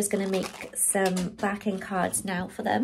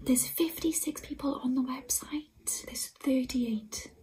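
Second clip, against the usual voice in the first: thrilled breathless whisper; 6 dB quieter than usual. Real, not acted. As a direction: whisper the good news, stay hushed. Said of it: "I'm whispering 'cause".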